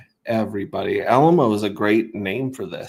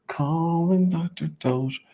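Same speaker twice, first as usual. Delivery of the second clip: higher, slow hospital-page voice; hammed-up paging of Doctor Doge; mock paging; 9 semitones higher, slower than usual. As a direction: page him like a hospital intercom.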